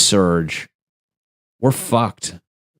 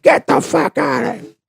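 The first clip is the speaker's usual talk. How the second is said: squeaky retort. Retold or imitated. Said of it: "And I'm like".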